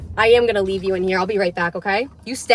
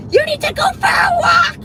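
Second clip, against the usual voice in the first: much higher, much louder mock shout; imitated yelling parent; 8 dB louder than usual. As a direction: belt it like a parent yelling at their kid. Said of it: "They yell at me and go".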